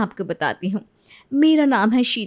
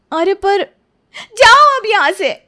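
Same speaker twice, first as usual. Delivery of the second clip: much higher and louder, get out; furious shout; 9 semitones higher, 8 dB louder than usual. Acted. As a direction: yell her out of the room. Wife throws rival out. (चिल्लाकर बाहर निकालना)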